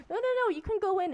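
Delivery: mock-urgent, high "no, no" reassurance; retold insistence. Old lady insisting in retold quarrel.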